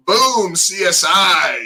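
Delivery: high, drawn-out mock boast; triumphant one-upping. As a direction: slam down the winning credit, stretched out slow. Voicing playful credit showdown at dinner.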